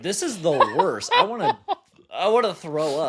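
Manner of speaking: laughing through the quote. Retold or imitated. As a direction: laugh while retelling it. Retold drunk gym quote, laughing.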